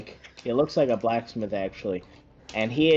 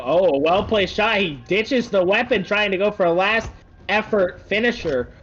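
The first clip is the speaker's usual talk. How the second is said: excited; 6 semitones higher, 3 dB louder than usual. Real, not acted. Hype commentary on a big play.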